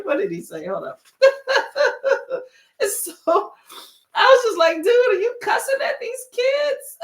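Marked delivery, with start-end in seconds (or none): 1.2-7.0 high laughing disbelief, nearly crying with laughter; laughing hard. Real, not acted. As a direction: squeal it through helpless laughter. Laughing while retelling reaction.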